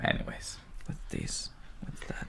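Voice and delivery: hushed whisper to a pet. Real, not acted. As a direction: whisper softly.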